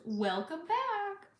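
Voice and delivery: a border officer's sweet drawn-out welcome. Retold or imitated. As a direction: stretch it out kindly, like greeting someone home. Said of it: "which felt really sweet and good".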